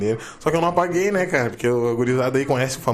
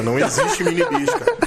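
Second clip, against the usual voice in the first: laughing. Real, not acted. Laughing disbelief.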